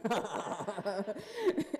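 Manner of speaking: real laugh after a silly voice; amused storyteller's laugh. Real, not acted. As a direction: let out a laugh at your own performance. Laughs after her mosquito voice.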